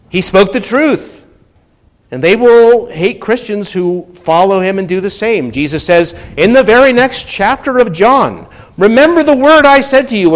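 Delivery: loud, high fervent preaching; preaching at full force. Acted. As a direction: preach it with fire.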